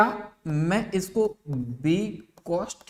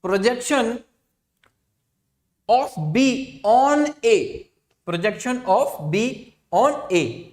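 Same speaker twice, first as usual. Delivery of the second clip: slow dictation; 4 semitones higher, 5 dB louder, much slower than usual. Shown as a demonstration. Teacher dictating key term.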